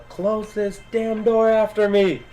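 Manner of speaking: high, drawn-out urgent shout; yelled order. Acted. Player's character heading outside, urgent high yell. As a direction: call the order out high and slow. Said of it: "and yell".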